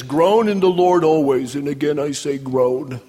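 hammed-up joyless groan of a verse; mock groaning. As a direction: moan the words out like a miserable believer. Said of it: "live as if the scripture says, 'Groan".

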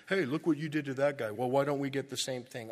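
fast, loud, envious complaint; envious workers griping at the landlord, fast and loud; jealous whining. Retold or imitated. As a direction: whine like a jealous sibling. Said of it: "that's what they're doing".

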